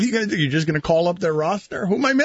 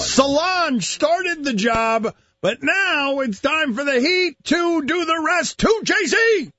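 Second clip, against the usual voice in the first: slow, rising hype voice building higher; hype announcement; 7 semitones higher, slower than usual. Acted. Dramatic hype intro.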